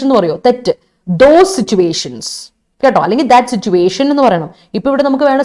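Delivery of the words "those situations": THOSE SITUATIONS drawn out slowly, falling at the end; slow, deliberate "Those situations"; emphatic correction. Shown as a demonstration. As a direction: give "Those situations" slowly as the right answer. Teacher gives the corrected phrase.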